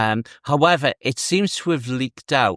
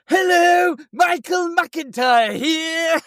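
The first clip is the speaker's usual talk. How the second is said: impressionist's Michael McIntyre; slower than usual. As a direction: shout it way up high like a cheesy impressionist. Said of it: "They always go".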